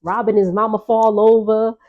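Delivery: ordinary talk, nothing marked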